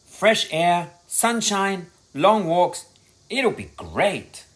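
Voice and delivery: cheerful enthusing. A narrator's reading. Dad sells the trip.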